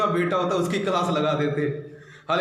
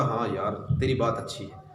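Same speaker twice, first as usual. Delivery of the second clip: lower, softer giving in; Naaman conceding; 8 semitones lower, 4 dB quieter than usual. Acted. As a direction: back down sheepishly. Naaman gives in.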